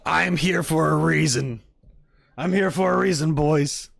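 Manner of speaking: high, loud playful declaration; mock-triumphant proclamation. Streamer proclaiming a destiny to the boys, mock-triumphant.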